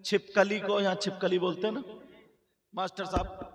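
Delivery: loud, sung-out sermon chant with music; preaching at full force. Acted. Preacher telling the village girls a warning tale, in a loud sung-out chant. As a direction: preach it to the gathering, chanting the lines.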